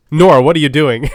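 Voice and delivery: scolding supervisor. Pretend. Supervisor catching Nora whispering on the job, mock scolding. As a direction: pretend to catch a worker out, loudly. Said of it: "supervisor's walking by".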